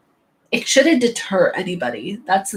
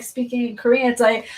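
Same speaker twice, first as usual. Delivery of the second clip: ordinary delivery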